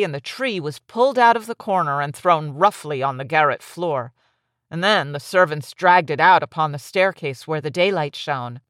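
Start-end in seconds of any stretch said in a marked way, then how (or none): none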